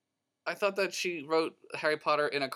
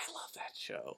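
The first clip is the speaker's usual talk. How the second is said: whisper; 19 dB quieter than usual. Real, not acted. Fond aside about Hogan's Heroes.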